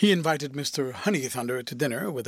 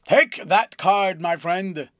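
loud, higher and slow, pressing the card on him; blustering command; 4 semitones higher, 7 dB louder, slower than usual. A narrator's reading. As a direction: push it on him loudly. Passenger thrusts card loudly.

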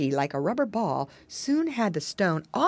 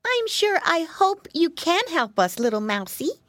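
put-on high little-boy piglet voice; apologetic piglet; 11 semitones higher than usual. Flop makes up to the mousy girl, high apologetic voice. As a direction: backpedal earnestly, pitched up.

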